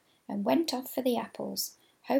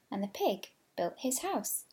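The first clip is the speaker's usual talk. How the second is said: ordinary delivery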